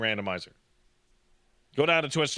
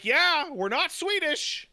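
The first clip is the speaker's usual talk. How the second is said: insistent developers; 12 semitones higher than usual. Acted. Finnish developers setting themselves apart from Swedes, high insistent voice. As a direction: pitch it up and insist on the difference.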